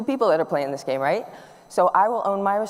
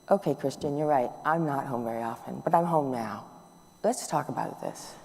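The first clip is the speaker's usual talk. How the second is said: softer, gentle and reassuring; calm the upset son down, softly; calm reply; 3 semitones lower, 5 dB quieter than usual. Retold or imitated. Calm answer to hurt son.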